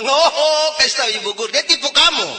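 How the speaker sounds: high, loud protesting rant; acted father's scornful tirade; indignant outburst. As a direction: object heatedly, as if cheated. Father indignantly rejects the proverb.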